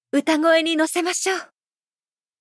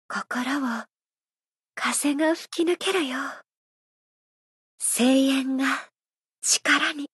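barely above a whisper, leaning close; soft hushed murmur; 3 semitones lower, 5 dB quieter than usual. Acted. Idol softly murmuring to her producer, hushed. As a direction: breathe the words softly to him.

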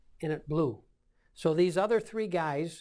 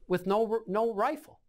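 normal talking voice

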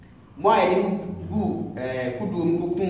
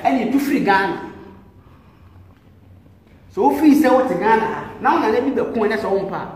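louder and higher, challenging; heated challenge; 4 semitones higher, 5 dB louder than usual. Real, not acted. Priest challenging a doubter about his ancestors, loud and heated. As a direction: hurl it at the doubter.

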